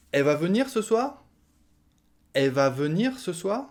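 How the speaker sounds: "elle" reduced to a bare "è"; "è va venir ce soir?" high, as a question; dropped L. Shown as a demonstration. Asking about the cousin tonight, L swallowed. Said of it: "Elle devient est".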